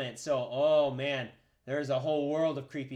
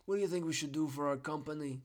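boss voice; 5 dB quieter than usual. Pretend. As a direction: play a smug company boss. Acting out company boardroom.

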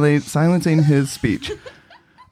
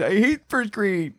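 whiny first grader; 7 semitones higher, slower than usual. Acted.

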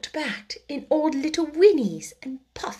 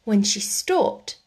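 ordinary delivery, nothing marked

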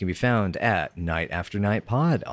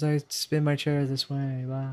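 fading out; 4 semitones higher, 4 dB quieter than usual. Pretend. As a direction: voice drifting away. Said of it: "let my voice fade and drift away".

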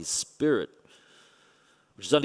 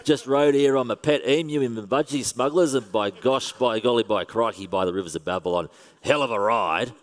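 broad Australian caricature. Pretend. Pastor mocking how Australian he didn't sound: exaggerated Australian accent. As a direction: lay on a thick Aussie accent for laughs. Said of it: "It's not like I said".

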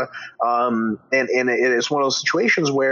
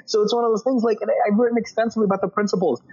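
much higher, heated exasperation; exasperated rant; 10 semitones higher than usual. Real, not acted. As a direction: vent at the clueless commenters. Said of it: "exasperated rant at commenters".

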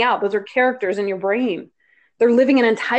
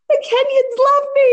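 much higher, giddy squeal; excited squeal; 4 dB louder than usual. Retold or imitated. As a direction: squeal it like thrilled news. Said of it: "I was like".

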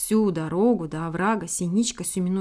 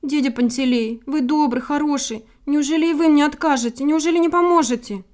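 high, loud, anxious little bird begging; acted near-crying appeal, higher pitched; tearful pleading; 6 semitones higher, 6 dB louder than usual. A narrator's reading. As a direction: plead urgently, close to tears. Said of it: "а потом взмолилась, чуть не плача".

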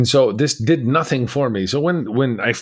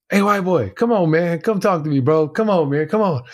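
high-pitched, insistent coaxing; pestering call; 8 semitones higher than usual. Retold or imitated. Prisoner badgers his neighbor every day to talk, pestering call. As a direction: keep nagging in a raised, high voice.

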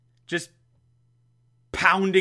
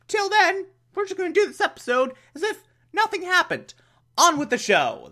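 mock chipper; 14 semitones higher than usual. Mock cheerful show host.

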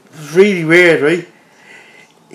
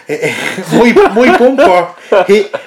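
laughing; 4 semitones higher, 4 dB louder, much slower than usual. Real, not acted. Laughing while telling crash.

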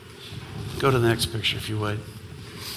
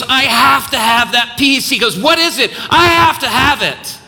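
desperate plea; 9 semitones higher, 5 dB louder than usual. Retold or imitated. Desperate man retold, pitch rising.

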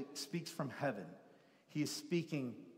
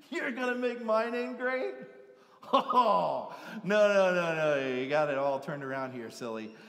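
amused God; 6 semitones higher, 10 dB louder than usual. Retold or imitated. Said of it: "And God's like".

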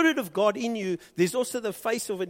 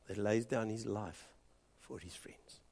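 whispered; 7 semitones lower, 11 dB quieter than usual. Real, not acted. Preacher hushes the last words of the verse into a whisper. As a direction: whisper "for his friends" very softly.

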